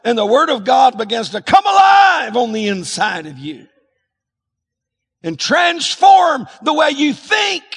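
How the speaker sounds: preaching shout. Real, not acted. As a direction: rise into full preaching force. Preacher building to climax.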